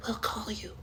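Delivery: soft, high brush-off; dismissive hush. Retold or imitated. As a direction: hush it with a phone gesture.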